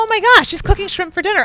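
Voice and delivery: high, loud mock astonishment; hammed-up breakthrough excitement; amazed realization. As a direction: exclaim it as the mystery suddenly solves. Acts out her sudden realization.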